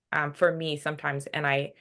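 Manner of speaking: ordinary talk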